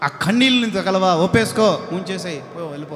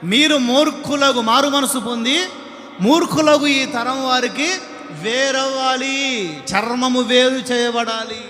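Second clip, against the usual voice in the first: building pulpit sermon, louder and higher; fiery preaching; 4 semitones higher, 4 dB louder than usual. Acted. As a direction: preach it harder line by line. Preacher builds to full force.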